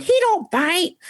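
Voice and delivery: very high mock owner voice; exaggerated high-pitched impression; mimicked dog owner. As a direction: put on a silly high voice for the owner.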